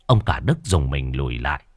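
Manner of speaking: ordinary delivery, nothing marked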